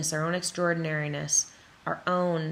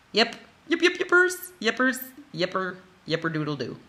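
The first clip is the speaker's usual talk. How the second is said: silly playful; 2 semitones higher than usual. Acted. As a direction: play with the words like a kid. Silly playful filler words.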